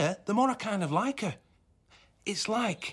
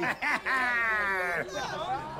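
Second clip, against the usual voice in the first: burst of laughter; 4 semitones higher, 4 dB quieter than usual. Acted. Sees Ronnie in pirate getup.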